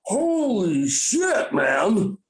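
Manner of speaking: mock awestruck stoner; stoned amazement. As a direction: stretch it out in tripping disbelief. Tripping buddy sees his parents.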